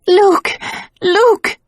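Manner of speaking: high, loud panicked scream; a woman screaming for Luke from a nightmare; screaming his name. A narrator's reading. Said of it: "found the voice to scream".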